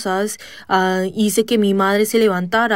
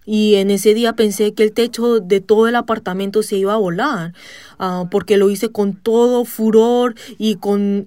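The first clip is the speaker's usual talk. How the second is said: usual speaking voice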